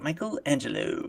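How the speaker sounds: quiet, theatrical reveal of the name; hushed mock announcement. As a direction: breathe the name out like a secret. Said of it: "He's like".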